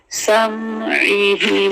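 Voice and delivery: समईहिम drawn out slowly, mim held calmly; slow Quran recitation. Shown as a demonstration. Teacher reciting समईहिम slowly for students. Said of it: "मीम को सुकून से पढ़ना है".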